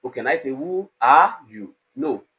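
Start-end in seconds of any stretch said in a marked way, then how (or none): 0.5-1.7 slow question, hit the word ARE; stress on ARE. Shown as a demonstration. Lecturer testing a student's question, stress on ARE. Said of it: "you are emphasizing are".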